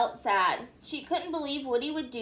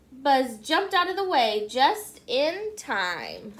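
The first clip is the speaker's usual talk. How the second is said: ordinary delivery, nothing marked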